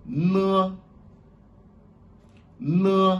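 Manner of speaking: "Ne" called out high and loud; Chinese letter N. Shown as a demonstration. Teacher drilling the Chinese letter name for students, high and clear. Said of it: "Le N".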